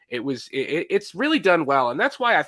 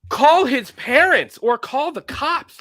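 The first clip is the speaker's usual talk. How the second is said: much higher, louder and slow, calling it out in disbelief; incredulous outburst; 12 semitones higher, 7 dB louder, slower than usual. Retold or imitated. Telling the coach what he should have done, a mock exasperated shout. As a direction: blurt it like you can't believe what you're watching. Mocking reaction to coach scene.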